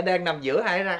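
ordinary delivery

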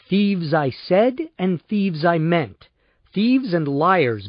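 slow, each word weighted; slow deliberate accusation. Acted. Dan accusing Miles and Belle, slow and deliberate.

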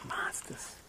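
whispered monsters; hushed Monsters. Shown as a demonstration. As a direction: breathe the word Monsters.

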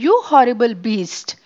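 loud furious yell at the beast; angry scream. A narrator's reading. Angry voice scolding the runaway tiger in the dark, screaming. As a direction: scream it in a rage. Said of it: "a voice screamed angrily".

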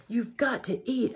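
high, pleading and near tears; tearful pleading. A narrator's reading. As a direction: beg him to eat, close to crying. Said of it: "I started to cry".

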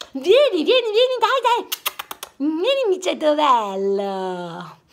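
high cooing over a kitten; baby talk to pet. Acted. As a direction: coo sweetly at a kitty. Lady coos at cat.

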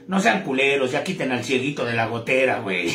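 low gruff mock priest telling them off; priest scolding. Priest scolding the worshippers, low mock grumble. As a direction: drop the voice low like a grumpy priest. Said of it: "le hace".

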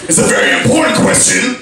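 high, hammed-up urgency; insistent burly guy. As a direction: press the point as the crazy stranger. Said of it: "He's like".

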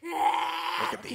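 high, slow drawn-out call; excited call. Real, not acted. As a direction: stretch it out in shock. Commentator reacts to gruesome face.

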